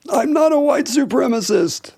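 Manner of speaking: high, slow comic painter voice; Norman Rockwell. Acted. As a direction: deny it in a drawn-out, high put-on voice. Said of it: "That's my Norman Rockwell impression".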